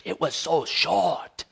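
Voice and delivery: put-on a light West African accent, slow and drawn out; a hint of rich West African accent. Pastor telling the preacher, in a light West African accent. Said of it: "that rich West African accent".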